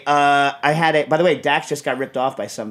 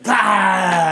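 Hulk Hogan shout; 4 semitones higher, 5 dB louder than usual. Retold or imitated. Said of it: "a Hulk Hogan, like, like, shirt rip".

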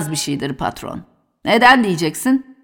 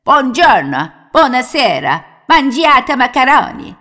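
lightly hammed-up Italian admiral voice, higher; mock Italian; 5 semitones higher, 3 dB louder than usual. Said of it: "Tam Cannavaro oluyorum". (hafif abartılı İtalyan aksanıyla, şakacı)

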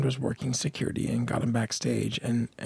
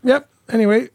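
curt brush-off; 10 semitones higher, 8 dB louder than usual. Retold or imitated. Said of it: "he was just like".

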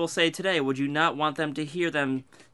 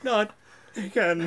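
hammed-up sad line; mock sad; 5 semitones higher than usual. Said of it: "Listen, be more sad".

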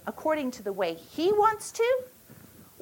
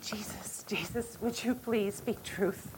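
much softer, breathless whispered plea; whispered prayer; 3 semitones lower, 11 dB quieter than usual. Retold or imitated. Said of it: "And I prayed".

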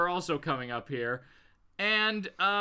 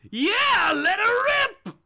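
high, loud gleeful yell; wild goading yell; 8 dB louder than usual. Acted. Villain urging the gunman to fire, wild goading yell. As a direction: shriek it like a villain egging him on.

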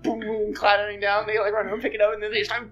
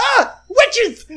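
high, loud scream of alarm; panicked shout; 14 semitones higher, 10 dB louder than usual. Acted. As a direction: scream it in terror at the sight. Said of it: "he yells".

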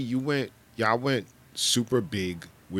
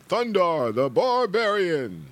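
cartoon announcer; 8 semitones higher, 4 dB louder, slower than usual.

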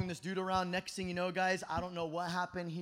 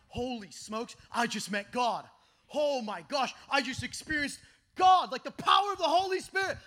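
stoked, awestruck; 2 semitones higher, 3 dB louder than usual. Retold or imitated. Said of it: "I got so stoked".

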